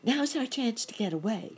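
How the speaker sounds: high-pitched urgent cricket; sudden high cricket cry. A narrator's reading. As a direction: call to a friend, pitched well up. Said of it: "suddenly cried the cricket".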